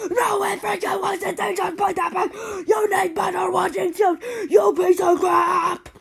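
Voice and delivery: high, loud, tearful yelling; shouting that they put the brick back, furious. Retold or imitated. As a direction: rage at them through tears. Rejecting mom's claim angrily.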